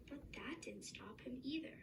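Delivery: ordinary delivery, nothing marked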